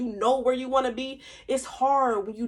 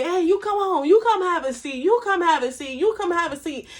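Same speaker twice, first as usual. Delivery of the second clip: generous host welcome; 6 semitones higher, 5 dB louder than usual. Retold or imitated. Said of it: "spread it all out and say".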